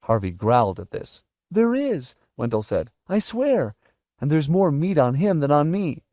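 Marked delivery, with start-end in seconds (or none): 1.5-2.1 high, pleading squeal; frightened insistence. Acted. Terrified boy bargaining for life. 3.1-6.0 put-on high, pleading boy's voice; frightened bargaining. Terrified boy bargaining for life.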